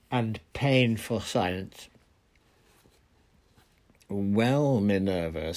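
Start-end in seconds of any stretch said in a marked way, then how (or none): 4.1-5.5 drawn-out, nasty opening. A narrator's reading. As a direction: start loud and let it sink nastily. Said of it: "said Fudge nastily".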